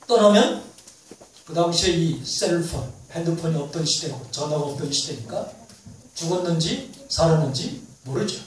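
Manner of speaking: ordinary talk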